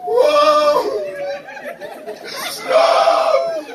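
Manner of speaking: hammed-up mourner's wail, high and loud; mock wailing. Hired mourners wailing at corpse.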